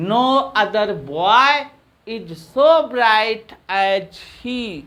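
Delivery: very slow, every word stretched; slow dictation. Shown as a demonstration. Teacher to students copying the sentence, slow dictation. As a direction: spell it out slowly so students can write. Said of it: "teacher dictates again".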